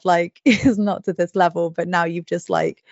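ordinary talk, nothing marked

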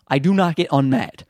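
normal talking voice

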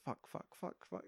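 whispered swearing on the edge of the seat; tense whisper. Retold or imitated. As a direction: breathe the curses in fear. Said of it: "You're like".

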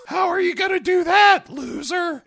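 very high, loud squeaky taunt; put-on shrieky kid challenge. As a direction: jeer like an obnoxious teen. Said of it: "then the kid asks".

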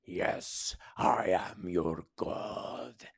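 hushed, drawn-out divine voice; goddess voice. A narrator's reading. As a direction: intone it slowly as a goddess. Goddess answers in narrated story.

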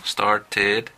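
"Started" broken into star-ted; separate "ed" syllable. Shown as a demonstration. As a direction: sound out the "ed" of "Started" clearly. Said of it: "que es ed".

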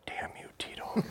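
breathy whispered frustration under the breath; whispered curse. Real, not acted. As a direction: hiss it at the dog so only you hear. Frustrated trainer curses at dog.

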